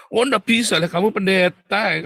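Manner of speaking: high, loud rebuke; stern confrontation. Acted. Counselor to a pastor, loud refusal of leniency.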